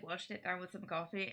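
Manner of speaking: ordinary talk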